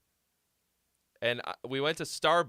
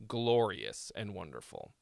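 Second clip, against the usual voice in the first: ordinary delivery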